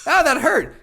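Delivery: high mock wince. Sympathising with the burned guy, high mock wince.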